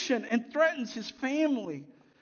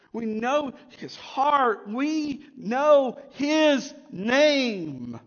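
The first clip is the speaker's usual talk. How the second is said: slower and louder, stretching each word; building proclamation; 4 dB louder, much slower than usual. Real, not acted. Pastor proclaiming God's name to his church, slow and rising.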